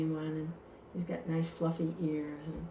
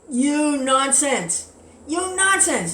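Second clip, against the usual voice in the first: slow, much higher, loud scolding; furious outburst; 8 semitones higher, 8 dB louder, much slower than usual. Retold or imitated. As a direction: spit it out, stuck and raging. Rabbit stuck, cursing tar baby.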